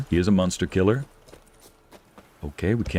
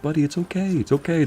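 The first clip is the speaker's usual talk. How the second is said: put-on baby talk to dog; 8 semitones higher than usual. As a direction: coo it high like to a scared dog. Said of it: "I'm like".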